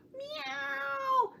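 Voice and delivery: long high meow; cat meow. Pretend. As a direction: meow like a kitten. Cat sound in rhyme.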